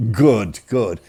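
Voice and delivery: mother's emphatic phone approval, imitated; loud, lightly put-on telephone voice; doctor's voice. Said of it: "the doctor's voice on the phone".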